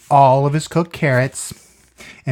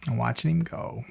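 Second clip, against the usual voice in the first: ordinary delivery, nothing marked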